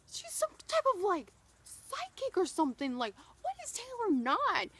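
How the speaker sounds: very high, loud awed squeal; amazed gush. Retold or imitated. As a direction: gush in wide-eyed awe. Fan amazed, retelling inner thought.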